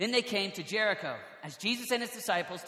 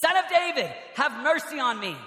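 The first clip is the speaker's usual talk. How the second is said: raised, loud cry for mercy; shouting all the more; 5 semitones higher, 5 dB louder than usual. A narrator's reading. Blind man calling to Jesus over the crowd, shouting. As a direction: cry out high, then ease down. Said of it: "but he shouted all the more".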